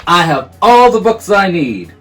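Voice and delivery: loud insistence. Acted. Bear cutting Mouse off.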